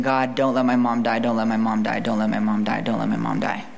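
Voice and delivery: understated prayer, repeated like a chant; retold crying out. Girl praying for her sick mom, low retold plea. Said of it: "I was crying out to God".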